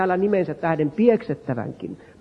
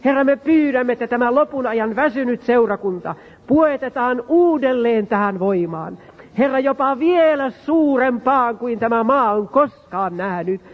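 preaching at full force; 5 semitones higher than usual. Acted. Preacher crying out to the Lord before the church, impassioned. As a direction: cry out to God, pleading, building.

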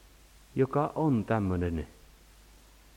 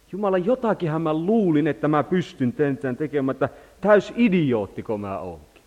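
exasperated prayer; 5 semitones higher, 6 dB louder than usual. Retold or imitated. Voices a believer's despairing prayer.

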